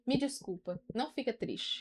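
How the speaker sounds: very soft, small apology; apologetic child. Retold or imitated. Imitating her son apologising to mom, very soft and contrite. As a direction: imitate a sorry little child, hushed. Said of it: "ele já olha pra mim e fala".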